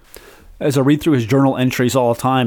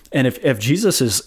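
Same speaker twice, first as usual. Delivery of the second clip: ordinary talk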